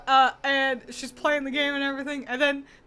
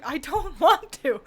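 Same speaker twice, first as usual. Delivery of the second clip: laughing refusal; 4 semitones higher, 5 dB louder than usual. Retold or imitated. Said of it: "And I was like".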